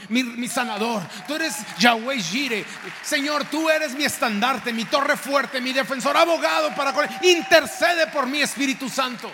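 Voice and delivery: oración gritada con fervor creciente; fervent shouted prayer. Acted. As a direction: cry out to God with rising power.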